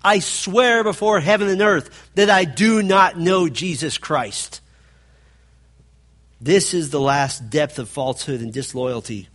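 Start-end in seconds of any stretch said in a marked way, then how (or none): 0.0-4.6 loud, raised, emphatic oath; swearing an oath. Acted. Peter swearing he does not know Jesus, loud and emphatic. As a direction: swear it forcefully, dropping on the last word. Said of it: "He's taking an oath".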